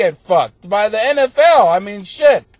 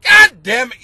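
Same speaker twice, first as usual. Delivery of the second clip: mock exasperated outburst; 4 semitones higher, 8 dB louder, slower than usual. Snapping at corny fat jokes.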